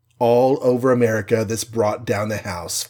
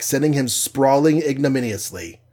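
ordinary delivery

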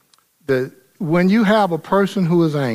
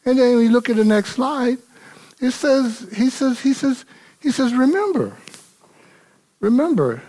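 preaching at full force; 8 semitones higher than usual. Acted. Pastor urging his church on how to answer anger, full force. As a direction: lift the congregation with rising fire.

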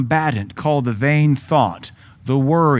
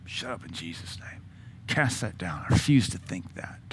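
much softer, low muttered rebuke; under my breath; 5 semitones lower, 12 dB quieter than usual. Retold or imitated. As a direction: hush it to yourself but firm. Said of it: "speaking to a mountain under my breath".

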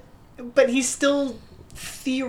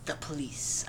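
grave whisper; 5 semitones lower, 13 dB quieter than usual. Retold or imitated. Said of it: "it's so grave".

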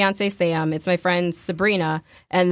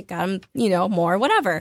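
ordinary talk, nothing marked

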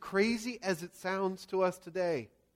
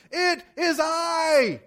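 slow, high, full-lunged shout; yelling; 9 semitones higher, 7 dB louder, much slower than usual. Acted. Jesus calling to disciples through howling wind, yelling. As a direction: yell each word out over the wind. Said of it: "yelling this at the top of his lungs".

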